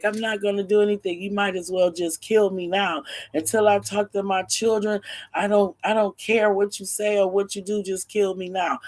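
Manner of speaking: a touch of desperate stubbornness; defiant father. Acted. Father defying the kidnapper.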